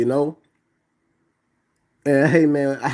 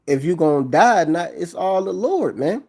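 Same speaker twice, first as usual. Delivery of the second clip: ordinary delivery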